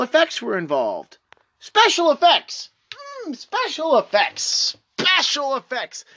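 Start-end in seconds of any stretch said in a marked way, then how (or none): 1.6-6.0 scornful sing-song repeat, higher; mock the outdated phrase. Creator scoffing at Newsweek, mocking repeat.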